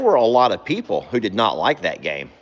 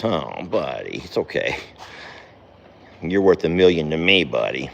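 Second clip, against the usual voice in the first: soft, low comforting; tender; 6 semitones lower, 4 dB quieter than usual. Real, not acted. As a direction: soothe the horse gently.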